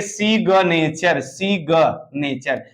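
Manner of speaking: सी ग नेचर broken into parts with an audible ग; सी ग नेचर, slowly split to show the hard g; G pronounced. Shown as a demonstration. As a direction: sound out the ग separately in सी ग नेचर. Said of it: "तब इसको हम ग पढ़ने लगते".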